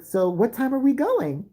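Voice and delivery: high, slow mock-kid question; eager kid. Classmate expects the movie date, high eager kid voice. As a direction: mimic a kid asking, drawn out and high. Blackmailing kid asks about outing.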